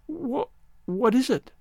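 high, slow, stammering fear; frightened stammer. Acted. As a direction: stutter it out, scared. Frightened girl stammers question.

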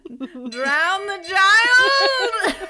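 loud, slow mock mob cry; laughing through a mob shout; angry mob leader.

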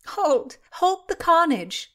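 high, loud playful command; laughing mock cry. A narrator's reading. Constance stops her friend's pretend sword death, playful cry. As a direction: cry out in mock horror, pitched right up. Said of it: "cried Constance, laughing".

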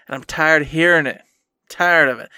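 ordinary delivery

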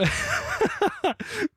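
amused, high laugh; real laugh. Real, not acted. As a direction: laugh out at a stressed friend. Host laughs at pressured contestant.

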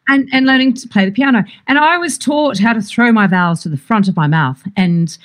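usual speaking voice

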